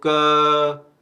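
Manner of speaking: drawn-out "क", the vowel swelling out; vowel held loud. Shown as a demonstration. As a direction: hold the uh of "क" on a level note. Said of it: "अ का sound यहाँ पर राइज करता है".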